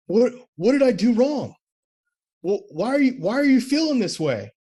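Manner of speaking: mock the clueless husband, high and pleading; typical guy. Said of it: "he did the typical guy thing".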